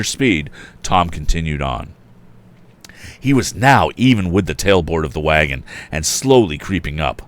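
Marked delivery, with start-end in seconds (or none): none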